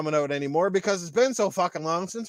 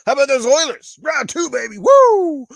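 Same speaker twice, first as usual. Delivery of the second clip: much louder, rising sports-fan whoop; excited cheering; 5 semitones higher, 9 dB louder than usual. Real, not acted. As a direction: hype the team win ending in a whoop. Cheering for hockey team.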